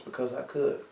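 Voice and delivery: mild understated quote; quiet retold reply. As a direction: say it softly, matter-of-fact. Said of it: "And his response was".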